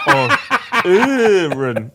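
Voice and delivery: very slow, high, loud laughing Fonz drawl. Pretend. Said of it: "say it like the Fonz".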